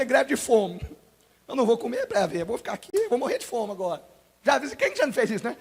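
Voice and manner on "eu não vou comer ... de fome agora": put-on sulking child.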